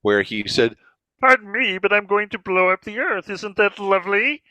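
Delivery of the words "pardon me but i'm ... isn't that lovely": much higher, reedy Kermit-style Martian; Marvin the Martian. Shown as a demonstration. Impressionist showing Marvin's later Kermit-style voice, much higher. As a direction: sweetly threaten in a tiny frog-like voice. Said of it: "Kermit the Frog style voice".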